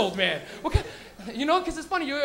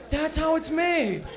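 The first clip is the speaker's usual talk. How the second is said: slow stoned marvel; 4 semitones higher, much slower than usual. Retold or imitated. Stoned awe at How It's Made.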